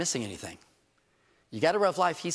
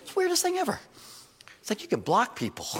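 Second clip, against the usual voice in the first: much higher, wide-eyed mock wonder; mock amazement; 11 semitones higher than usual. Preacher marvelling at Facebook's block button, high mock wonder. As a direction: marvel at it like a miracle.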